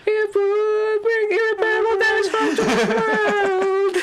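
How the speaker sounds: very high, slow ghostly singing; eerie high wail. Pretend. High eerie drawn-out sung reading.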